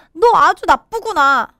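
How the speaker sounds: acted protest; crying out. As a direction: cry it out at the bully. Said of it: "주드가 소리쳤어요".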